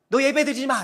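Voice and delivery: loud, sharp prohibition; stern rebuke. Retold or imitated. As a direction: scold as a disciplining father. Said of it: "엄청난 훈육이 있어요".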